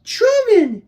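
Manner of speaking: very high, loud cry of Truman; a girl's overjoyed, high-pitched Truman!; glad cry. Acted. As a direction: call out in delighted relief. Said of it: "she cried".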